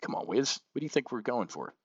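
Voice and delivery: normal talking voice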